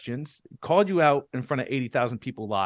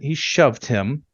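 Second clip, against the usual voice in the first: usual speaking voice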